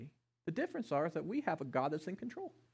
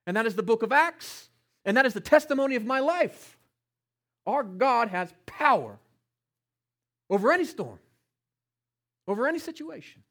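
loud, emphatic preacher, higher in pitch; full-force preaching; 6 semitones higher, 12 dB louder than usual. Real, not acted. Preacher testifying to God's power, loud and forceful. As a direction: proclaim it loudly, landing hard on each phrase.

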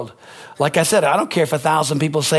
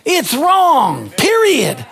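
preaching at full force; 7 semitones higher than usual. Acted. Pastor warning the congregation against fear, preaching hard. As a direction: hammer it home from the pulpit.

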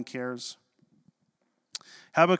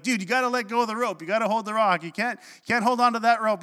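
higher-pitched imitation of urgent friends; mock pleading with a panicking buddy; urgent coaxing; 7 semitones higher than usual. Friends to boy clinging to the rope, urgent coaxing.

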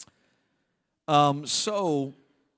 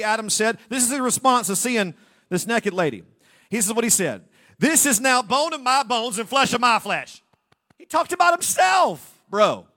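higher, louder scolding; mocking shout; 6 semitones higher, 8 dB louder than usual. Real, not acted. Preacher mocking Adam's self-centred answer, loud shout. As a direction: call Adam out loud.

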